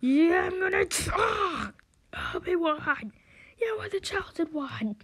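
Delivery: high, strained outburst; hammed-up duel-scene lament; mock anguished cries. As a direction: cry out high, then lament.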